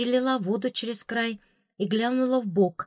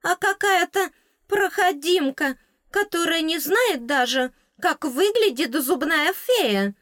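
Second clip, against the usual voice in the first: the fairy belittling her new owner; ranting fairy; 9 semitones higher, 3 dB louder than usual. A narrator's reading. Fairy continues rant.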